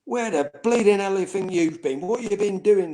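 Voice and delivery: imitating the furious gaffer; angry manager. Manager confronting late player, mock angry shout.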